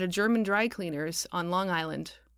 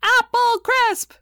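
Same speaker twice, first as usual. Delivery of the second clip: put-on high, loud, drawn-out children's shriek; 11 dB louder, much slower than usual. A narrator's reading. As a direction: shriek it like kids bouncing on a sofa.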